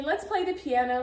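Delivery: ordinary delivery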